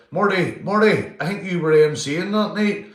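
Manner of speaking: high, loud call to a mate. Real, not acted. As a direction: call the name out high and loud, then carry on. Calling out to Marty.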